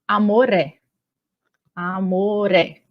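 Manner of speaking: "amoré" said as one linked word, the r running straight into the é; elisão. Shown as a demonstration. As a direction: link "amor é" into "amoré", spoken slowly for learners. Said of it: "eu fiz as elisões". ("amoré" com elisão, o r colado no é)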